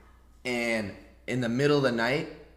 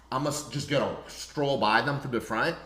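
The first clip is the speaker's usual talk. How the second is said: usual speaking voice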